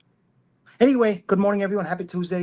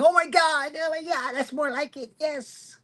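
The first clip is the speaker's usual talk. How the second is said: higher mock gushing of a liberal; 9 semitones higher than usual. Vlogger mocking a liberal's delight at the headline, high and hammed-up. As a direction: ham up a swooning fan, much higher. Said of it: "it's probably some liberal saying".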